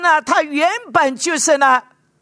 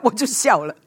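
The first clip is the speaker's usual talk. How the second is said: ordinary talk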